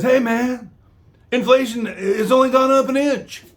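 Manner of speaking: high, dopey mocking old-man voice; mocking Biden. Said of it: "Then he says".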